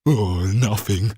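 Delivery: a thief's slow despairing groan. Acted. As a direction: sag with disappointment. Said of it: "he said despairingly".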